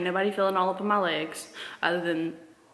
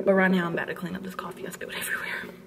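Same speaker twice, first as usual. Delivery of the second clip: whispering; 7 dB quieter, faster than usual. Real, not acted. Whispered aside to camera.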